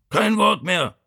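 high-pitched strained father; Vito. A narrator's reading. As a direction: cut off the argument from the sickbed. Father forbids further argument from bedroom.

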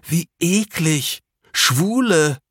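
high, loud, drawn-out revulsion; disgusted cry. A narrator's reading. Max reacts to the two men, disgusted cry. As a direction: stretch the words out high in disgust. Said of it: "rief Max".

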